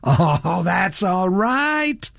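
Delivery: slow, rising, chuckly reassurance; good-natured laughing. A narrator's reading. Franklin brushing off the elder man's apology, amused. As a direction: stretch it upward, brushing off the apology. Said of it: "Rudge laughed".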